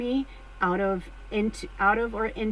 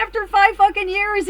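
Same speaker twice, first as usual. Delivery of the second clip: high, loud theatrical swoon; hammed-up shriek of disbelief; mock swoon; 12 semitones higher, 8 dB louder than usual. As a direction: ham up fainting from shock. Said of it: "I'm like".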